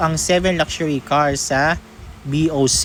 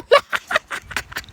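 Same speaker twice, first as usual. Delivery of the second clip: a high, airy burst of laughter; high breathy laugh. Real, not acted. Laughing at their own teacher quiz punchline, a high airy laugh. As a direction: crack up at the punchline.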